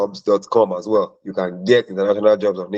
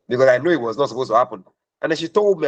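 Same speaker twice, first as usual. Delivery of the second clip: ordinary delivery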